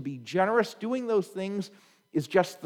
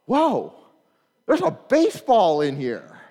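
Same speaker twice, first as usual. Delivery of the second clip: put-on loud, higher amazed kid voice; surprised kid; 4 semitones higher, 8 dB louder than usual. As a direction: gasp in wonder at the ball in the glove. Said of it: "they're like".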